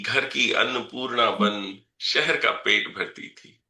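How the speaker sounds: measured, rhythmic reading of verse; poem recitation. Acted. Poet reciting a poem about his mother to the audience, measured recitation. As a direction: recite each line and let it land.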